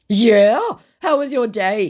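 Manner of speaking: high, loud mock interest; scammer's put-on voice. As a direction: ask eagerly as the scammer. Acts scammer's small talk.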